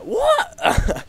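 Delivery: squeaky high shocked yelp of disbelief; shocked what. Retold or imitated. Retelling the moment he got spat on, a loud shocked high squeak. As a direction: blurt it out stunned and high. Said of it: "I was shocked".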